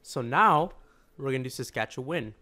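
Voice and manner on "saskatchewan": SASKATCHEWAN carefully ended in "win"; corrected Saskatchewan, WIN ending. Shown as a demonstration. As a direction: say the last syllable of Saskatchewan as "win". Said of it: "let's try this again".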